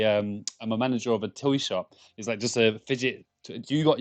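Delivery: ordinary delivery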